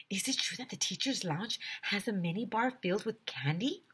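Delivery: whispered secret question. Acted. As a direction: hush it like a kid sharing a rumour. Kid asking a secret rumour.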